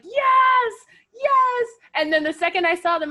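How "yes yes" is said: very high, loud cheer; retold joyful scream. Retelling seeing favourite wrestlers appear, high joyful scream. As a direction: scream it out in triumph, re-enacting. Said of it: "I was screaming, like".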